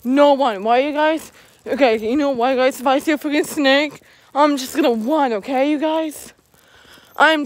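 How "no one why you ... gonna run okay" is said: ordinary talk, nothing marked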